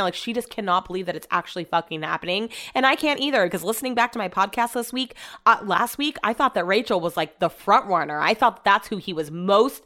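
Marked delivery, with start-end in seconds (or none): none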